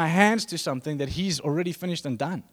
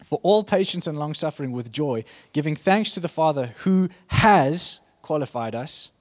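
firm, ringing scripture read; declaring the Bible passage, measured and strong; 3 semitones higher, 5 dB louder than usual. Acted. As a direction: proclaim the verses to the church. Reads Colossians aloud.

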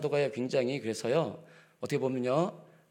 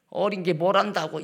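dismissive; 6 semitones higher than usual. Shown as a demonstration. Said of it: "이게 아니고요".

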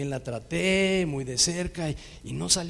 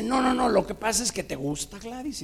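higher and a bit louder, insistent no no no; jealous insisting; 7 semitones higher, 4 dB louder than usual. Retold or imitated. The girlfriend insists he likes Gladys, higher and insistent. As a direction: keep pressing the accusation.

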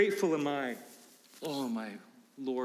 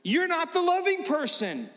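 much higher, louder rebuke; heated rebuke; 10 semitones higher, 3 dB louder than usual. Retold or imitated. Pastor rebuking a critic in a high, loud voice. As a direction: tell them they have it backwards. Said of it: "And I would say".

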